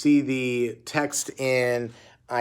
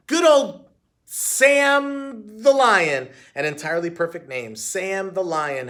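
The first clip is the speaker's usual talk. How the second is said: hammy bluff; 5 semitones higher, 6 dB louder, slower than usual. A narrator's reading. Heidi bluffing the name.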